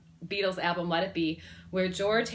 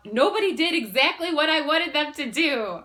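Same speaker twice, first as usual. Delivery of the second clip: high, loud, blurting it out; blurted out; 6 semitones higher, 6 dB louder than usual. Retold or imitated. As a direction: burst it out all at once. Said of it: "blurted out".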